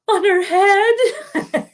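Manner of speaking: very high, drawn-out tearful wail; mock sobbing. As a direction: squeak out the awful detail through fake tears. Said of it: "I was sobbing so much".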